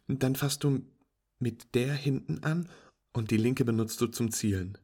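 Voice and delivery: walk a beginner through it brightly; patient instructing friend. Acted.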